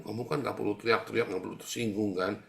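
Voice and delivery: ordinary delivery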